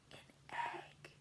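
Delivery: dramatic hushed whisper. A narrator's reading. Boy revealing his stain's shape in a dramatic whisper. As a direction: breathe the words after a pause. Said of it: "he whispered".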